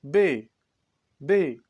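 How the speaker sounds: बे said slowly and clearly; letter name demo. Shown as a demonstration. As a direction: spell out बे like a teacher. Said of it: "जैसे".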